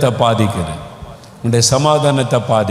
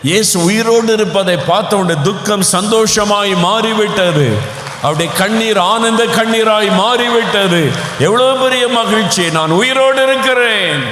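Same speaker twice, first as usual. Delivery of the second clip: high, ringing shouts of joy; joyful shout; 7 semitones higher than usual. Acted. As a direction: cry out the resurrection joy. Joyful climax of resurrection. (மகிழ்ச்சியுடன் உரத்த ஆர்ப்பரிப்பு)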